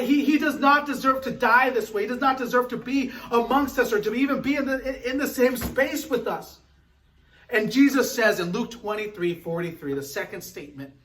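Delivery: ordinary talk, nothing marked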